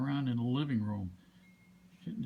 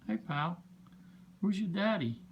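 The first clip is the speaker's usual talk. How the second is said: pet talk; 5 semitones higher than usual. Real, not acted. Baby talk to the bird.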